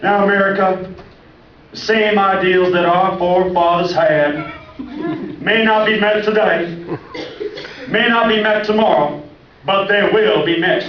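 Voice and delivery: high, slow, loud oratory with pauses; hammed-up Obama speech; mock Obama speech. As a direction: deliver it like a presidential address. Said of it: "And he's like".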